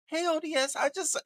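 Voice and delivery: high, squeaky mock of a flustered woman; mocking imitation. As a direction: parody her nervous start. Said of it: "she was like".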